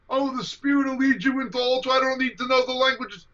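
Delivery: high, mocking imitation of a naive believer. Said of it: "This is a combination of idiocy and Gnosticism".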